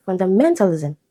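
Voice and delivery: "fundamentalism" stressed on MEN; third-syllable stress. Shown as a demonstration. Teacher showing suffix keeps root stress, third-syllable stress. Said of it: "third syllable".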